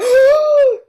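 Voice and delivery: loud, high sharp gasp of joy; thrilled gasp. Retold or imitated. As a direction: gasp in disbelief at the news. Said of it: "they heard me going".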